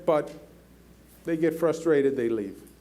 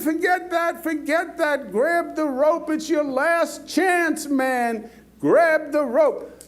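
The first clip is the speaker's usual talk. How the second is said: urgent pleading yell; 7 semitones higher, slower than usual. Retold or imitated. Officials in the helicopter plead with the stubborn farmer, urgent yell. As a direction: beg him to grab it before it's too late.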